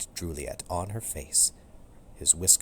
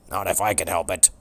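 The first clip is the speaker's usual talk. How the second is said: louder gruff dog voice, a barked line; dog barking reply; 6 dB louder than usual. Acted. Turbo the dog warning off the cat, barked. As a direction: bark it out as the guard dog. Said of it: "Turbo barked".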